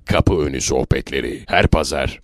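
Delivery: very low, resonant show announcement; radio jingle voice. Shown as a demonstration. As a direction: announce the show in a deep promo voice. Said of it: "Sese bak abi". (çok pes tanıtım anonsu)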